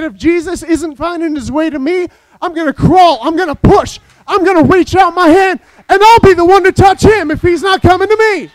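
from firm to a loud, high cry; desperate resolve swelling to a shout; building determination. Acted. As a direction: push harder with each phrase until it rings. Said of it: "she said".

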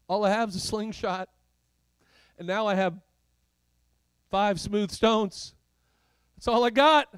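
building to a high, loud plea; mounting protest. Acted. Teenage shepherd telling God he lacks means, rising protest.

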